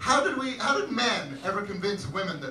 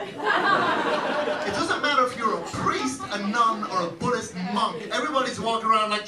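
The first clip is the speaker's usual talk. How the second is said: ordinary delivery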